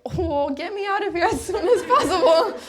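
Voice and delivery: high, wailing plea played for laughs; hammed-up desperation to get out; comic panicky whine, pitched high; mock desperate plea. Acted inner plea to escape retreat.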